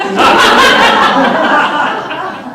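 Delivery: loud high laugh; hearty laugh. Real, not acted. Laughing at her own panic at the door. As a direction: burst out laughing.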